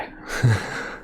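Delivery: brief amused chuckle; short laugh. A narrator's reading. Listener amused by a hell of a love story, chuckling. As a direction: chuckle softly after the remark.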